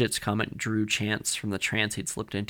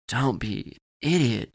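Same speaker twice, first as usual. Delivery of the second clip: slow, drawn-out put-down; mildly forced bravado, stretched out; manufactured bravado; slower than usual. A narrator's reading. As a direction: scoff with put-on confidence. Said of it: "said with manufactured bravado".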